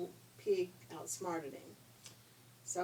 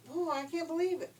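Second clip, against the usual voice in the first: frustrated wolf; 9 semitones higher, 6 dB louder than usual. Acted.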